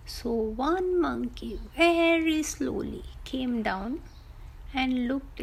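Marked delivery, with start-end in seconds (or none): none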